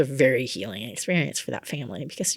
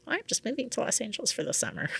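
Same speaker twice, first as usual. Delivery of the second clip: mock casual; 8 semitones higher, 6 dB quieter, faster than usual. Mocks her own self-deception.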